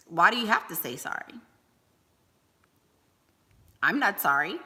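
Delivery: ordinary talk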